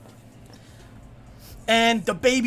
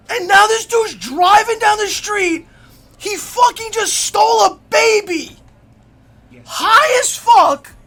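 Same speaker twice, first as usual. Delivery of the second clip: loud, high, building hype; excited shouting; 10 semitones higher, 8 dB louder, slower than usual. Acted. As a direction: yell the twist like you can't believe it. Story climax, narrator freaking out.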